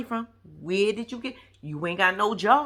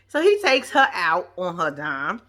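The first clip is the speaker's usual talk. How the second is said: usual speaking voice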